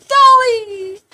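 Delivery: high, loud, drawn-out holler; excited call. Retold or imitated. Fan recalling [name]'s show on a plane, excited call. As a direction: call the name out high and long.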